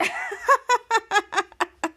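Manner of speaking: high staccato ha-ha laugh; long put-on giggling laugh; imitated laughter. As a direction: act out kids cracking up. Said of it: "and the kids laughed".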